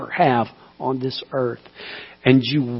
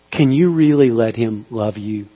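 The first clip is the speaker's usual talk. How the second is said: ordinary talk, nothing marked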